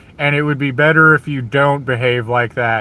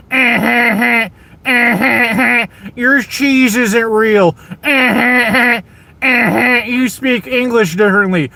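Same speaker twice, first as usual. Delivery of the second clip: higher-pitched jeering cackle, taunting; higher mock laugh sneering at an accent; mocking laughter; 7 semitones higher than usual. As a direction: laugh at them like a schoolyard bully, higher up. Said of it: "you did something other than".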